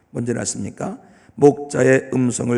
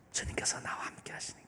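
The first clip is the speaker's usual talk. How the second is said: whisper; 18 dB quieter than usual. Acted. Intimate point about the Lord.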